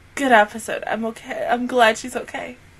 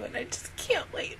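soft unvoiced whisper; 3 semitones higher, 16 dB quieter than usual. Real, not acted. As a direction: breathe it out secretly. Excited whisper.

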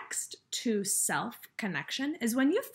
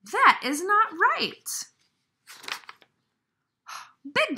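a lightly voiced elephant, louder and outraged; a big friend getting worked up on Piggy's behalf; indignant Gerald; 5 dB louder than usual. Reads Gerald's indignant lines.

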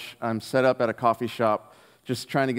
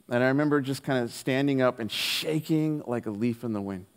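ordinary talk, nothing marked

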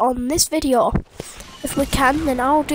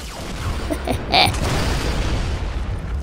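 chuckling. Pretend. Evil laugh after taunt.

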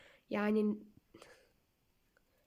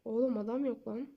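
ordinary delivery

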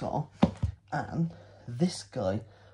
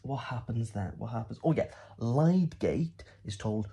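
ordinary talk, nothing marked

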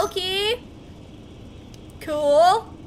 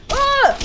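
pained grunt; 9 semitones higher, 5 dB louder than usual. Real, not acted. Hit during firefight.

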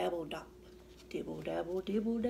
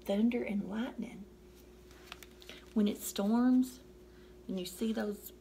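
plain reading voice

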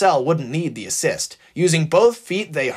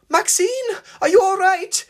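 much higher, louder frightened cry; petrified call; 4 dB louder than usual. Acted. Marcel calling to the fallen Maxine, high and frightened. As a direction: call out to her in fear. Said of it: "called Marcel, petrified".

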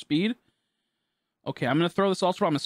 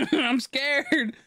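nervous squeal; 7 semitones higher than usual. Real, not acted. Nervous during shiny capture.

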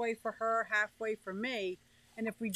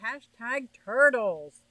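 announcing hashtag; 8 dB louder, slower than usual. Real, not acted. Repeating the chosen hashtag.

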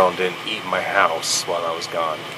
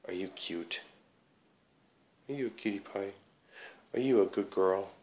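talking to a dog; 12 dB quieter than usual. Real, not acted. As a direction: coo softly at the dog. Cooing to dog.

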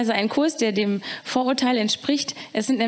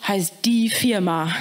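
slow, lower correction; a hint of deep Mohammed; 3 semitones lower, slower than usual. Mohammed correcting the classmates, slow and lower. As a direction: drop the voice and correct calmly.

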